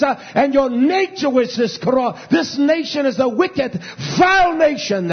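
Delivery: loud, high shouted rebuke of the nation; preaching at full force. Real, not acted. Preacher shouting down judgment on a wicked nation. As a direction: thunder it from the pulpit.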